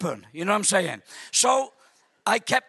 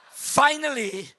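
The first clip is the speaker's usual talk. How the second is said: ordinary talk, nothing marked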